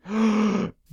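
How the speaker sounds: sharp high gasp of surprise; surprised gasp. Real, not acted. Spotting the ocelot, a high surprised gasp. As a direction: suck in a gasp of delight.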